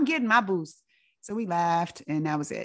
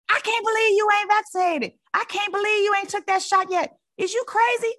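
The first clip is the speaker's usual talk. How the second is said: auntie going off; 13 semitones higher than usual. Retold or imitated.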